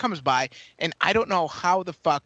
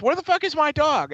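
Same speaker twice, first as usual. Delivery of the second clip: retold frantic yell, higher and louder; angry yell; 9 semitones higher, 3 dB louder than usual. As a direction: demand it in a panic. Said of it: "she goes".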